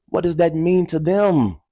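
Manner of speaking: usual speaking voice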